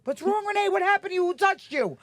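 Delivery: high and loud, worried demand; a father panicking over his crying daughter; alarmed father. Retold or imitated. Father questioning his crying daughter, alarmed. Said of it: "My father was like".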